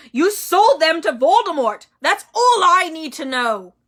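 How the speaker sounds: furious shout. A narrator's reading. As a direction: hurl it at him in fury.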